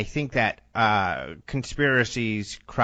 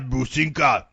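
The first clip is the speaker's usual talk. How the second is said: tough dog voice; barbarian. Pretend. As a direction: puff up like a tiny brute. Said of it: "He's like".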